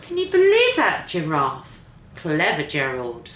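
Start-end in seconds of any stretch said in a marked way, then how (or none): none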